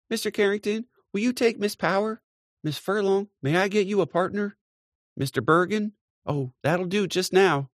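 high, brisk hostess voice; busy hostess. A narrator's reading. Mary Jane arranging partners, high and brisk. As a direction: sort out the dance partners quickly.

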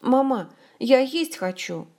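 put-on little boy's high, loud announcement; loud proclamation. A narrator's reading. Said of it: "громко провозгласил".

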